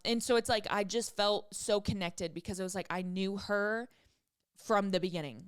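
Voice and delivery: ordinary delivery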